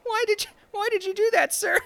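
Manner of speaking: very high sobbing whine; high whiny crying man. Acted. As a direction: blubber like a hurt kid. GM as crying thug.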